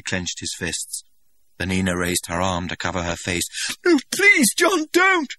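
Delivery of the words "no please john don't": high, loud frightened begging; terrified plea. Acted. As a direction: beg him not to strike her. She cowers from his fists.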